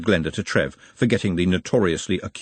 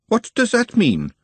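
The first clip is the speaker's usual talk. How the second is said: sharp, high and louder question; sharply; 13 semitones higher, 4 dB louder than usual. A narrator's reading. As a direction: snap the question out, suspicious. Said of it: "said Glenda sharply".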